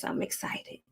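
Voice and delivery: breathy whispered I'm excited; whispering. Real, not acted. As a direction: whisper it to yourself. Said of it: "Maybe I'm just excited".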